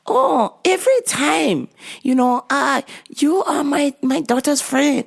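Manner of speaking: high put-on complaining mom voice. Old mom complaining the clerk is never helpful, high mimicked voice. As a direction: mimic the mom calling her unhelpful.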